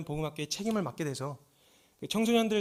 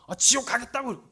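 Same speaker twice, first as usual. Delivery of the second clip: put-on higher, louder stubborn teen retort; defiant teen; 8 semitones higher, 8 dB louder than usual. The boy stubbornly refusing his teacher, higher and louder. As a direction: spit it back like a stubborn kid. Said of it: "버티는 거예요".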